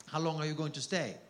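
usual speaking voice

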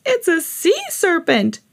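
high, slow scared cry; screaming. A narrator's reading. Beachgoer sees seaweed Harry, screaming. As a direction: scream it in alarm. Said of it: "one of them screamed".

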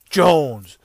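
loud punched name like an on-screen graphic; mock graphic announcement. Mocking the Giants highlight reel's name graphic, loud punch.

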